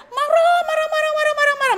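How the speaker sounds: high, loud mock angry chant, repeated; endless anger. Shown as a demonstration. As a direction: hammer out marah over and over, high and loud. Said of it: "Dendam itu marah yang lama".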